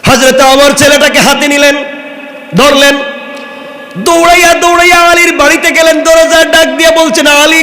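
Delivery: high, loud sermon delivery; impassioned preaching. Acted. Preacher telling the story of Husain to the crowd, impassioned. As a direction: sweep the crowd along in full voice.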